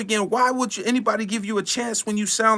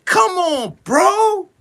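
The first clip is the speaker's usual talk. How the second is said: exasperated shout; 10 semitones higher, 4 dB louder, much slower than usual. Real, not acted. Exasperated cry out.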